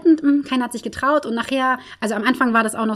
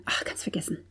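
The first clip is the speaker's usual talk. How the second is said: whisper; 11 dB quieter than usual. Real, not acted. Self-deprecating aside.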